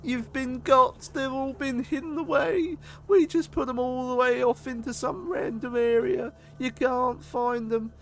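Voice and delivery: high, loud gloating prank show host; taunting TV prankster. Pretend. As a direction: gloat like a hidden camera host. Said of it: "I'm on one of them shows".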